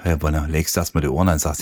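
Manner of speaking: ordinary delivery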